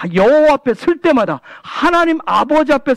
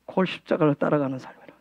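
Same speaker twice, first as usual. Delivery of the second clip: much softer, quicker throwaway repeat; quiet fast echo; 8 semitones lower, 10 dB quieter, faster than usual. Real, not acted. Pastor softly echoing his line to the congregation. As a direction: toss it off under the breath.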